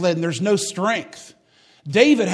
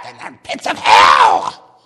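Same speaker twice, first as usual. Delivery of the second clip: much lower, much louder, slow booming shout; Bob Jones Senior impression; 8 semitones lower, 12 dB louder, much slower than usual. Imitating old preacher scaring a classroom, slow booming shout. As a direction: boom it out like an old hellfire preacher. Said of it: "And he talked like this".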